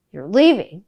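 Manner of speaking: can't believe she's leaving; indignant you're leaving; shocked question. Retold or imitated.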